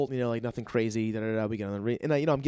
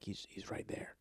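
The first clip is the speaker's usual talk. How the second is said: hushed; 4 semitones lower, 17 dB quieter than usual. Retold or imitated. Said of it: "They're like".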